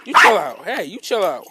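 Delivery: loud firm telling-off; scolding the dog loudly. Real, not acted. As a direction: order the dog to calm down.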